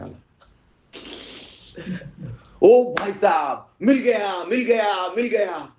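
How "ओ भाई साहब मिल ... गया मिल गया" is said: high-pitched, drawn-out cry of discovery; excited shouting. Acted. Beggar finds the diamond in the fish, shouting excitedly. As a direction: call out in hammed-up jubilation. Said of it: "तो ओरडायला लागला".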